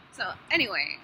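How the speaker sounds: normal talking voice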